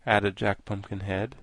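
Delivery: ordinary delivery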